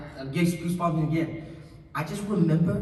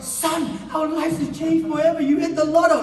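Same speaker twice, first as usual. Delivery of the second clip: high, very loud mock jubilation; over-the-top celebration; 12 semitones higher, 12 dB louder than usual. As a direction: shout it like a hammed-up jackpot win. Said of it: "there was no like".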